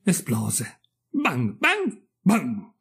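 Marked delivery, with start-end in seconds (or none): 1.1-2.7 high, punchy spoken bangs; gunpowder bangs. A narrator's reading. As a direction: imitate explosions, one bang at a time. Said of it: "fece la polvere da sparo". (scoppi imitati a voce)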